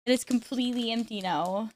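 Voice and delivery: usual speaking voice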